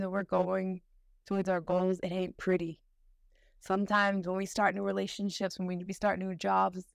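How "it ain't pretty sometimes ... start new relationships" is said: usual speaking voice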